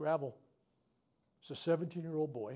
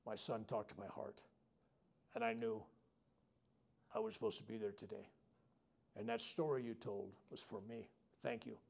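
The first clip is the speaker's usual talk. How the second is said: hushed grieving mother; 5 semitones lower, 10 dB quieter than usual. Retold or imitated.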